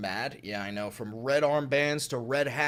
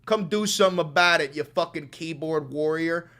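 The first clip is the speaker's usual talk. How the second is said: ordinary talk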